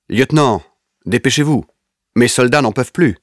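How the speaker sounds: high, mocking sneer through the door; sneering soldier. A narrator's reading. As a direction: taunt the lieutenant. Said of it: "Je l'entends ricaner".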